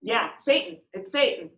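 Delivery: ordinary talk, nothing marked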